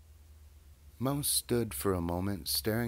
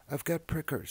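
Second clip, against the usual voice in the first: high pained rat; 11 semitones higher than usual. Acted. Rat complains of prickers.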